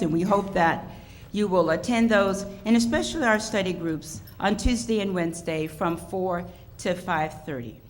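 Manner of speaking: normal talking voice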